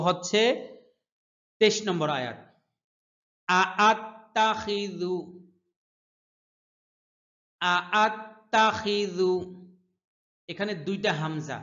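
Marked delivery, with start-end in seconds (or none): 3.5-9.3 slow melodic tajweed chant; measured Quranic cantillation; Quran recitation. Shown as a demonstration. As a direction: draw out each verse ending in song. Teacher chants the next verse.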